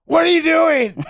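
high, sharp mock outburst; woken-up wife. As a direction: imitate a wife irritated at being woken. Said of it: "unless you wake her up".

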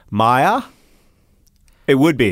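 tentative British "Maya", rising like a question; British accent. Acted. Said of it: "said with a British accent".

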